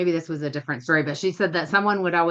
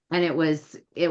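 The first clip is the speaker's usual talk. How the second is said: ordinary talk, nothing marked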